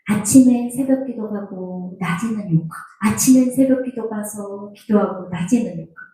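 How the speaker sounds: usual speaking voice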